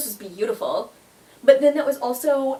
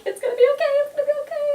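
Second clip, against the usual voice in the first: high, loud pleading reassurance, voice pitched way up; much higher, louder self-comforting plea; reassuring myself; 5 dB louder, much faster than usual. Retold or imitated. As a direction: soothe yourself in a squeaky high panic. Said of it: "and I'm like".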